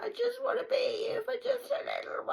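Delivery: high breathless whisper of a needy voice; whispered mock plea. Mocking the stalker's plea, a high whisper. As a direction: whisper it high like a clingy pest.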